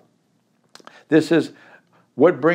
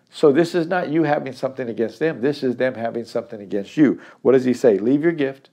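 ordinary delivery

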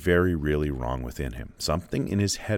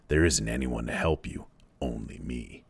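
menacing whisper; 5 semitones lower, 5 dB quieter than usual. Acted. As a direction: let the threat sink into a breath. Lord of the Flies threatens Simon.

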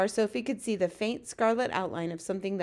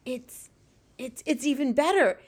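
halting, then rising into louder excitement; thrilled; 6 semitones higher, 5 dB louder than usual. A narrator's reading. Giant discovers a rare golden dream, thrilled. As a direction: stammer into delighted discovery, pitch climbing. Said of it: "with a thrill in his voice".

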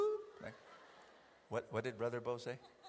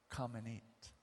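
soft, low, tender offer; gentle invitation; 4 semitones lower, 8 dB quieter than usual. Acted. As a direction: invite him kindly and quietly. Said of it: "this is what Jesus said".

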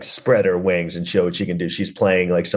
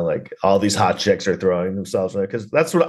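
usual speaking voice

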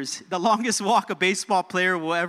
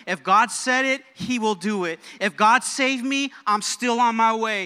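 raised, rhythmic sermon refrain; rousing preaching; 4 semitones higher, 4 dB louder than usual. Real, not acted. Preacher rallying believers that God will do it, rousing refrain. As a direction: drive each phrase home, lifting the pitch on every "if".

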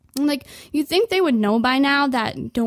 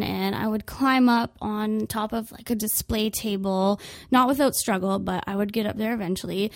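normal talking voice

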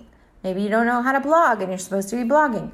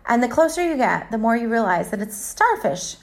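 ordinary talk, nothing marked

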